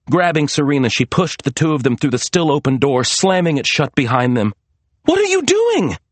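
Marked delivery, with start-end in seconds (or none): 5.0-6.0 very high, startled question; bewildered cry. A narrator's reading. Serena as Maria slams the door, bewildered cry. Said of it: "Serena cried, bewildered".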